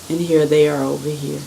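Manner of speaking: ordinary delivery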